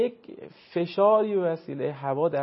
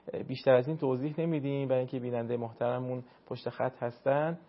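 usual speaking voice

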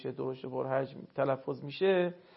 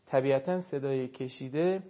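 ordinary talk

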